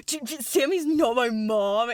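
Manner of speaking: stammering, hammed-up defensive outburst; exaggerated indignant protest. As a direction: sputter it in mock outrage. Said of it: "he doesn't say it like that".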